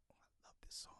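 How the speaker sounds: breathy whisper of the line. Retold or imitated. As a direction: whisper it like the gif. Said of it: "You've seen the gif of him going".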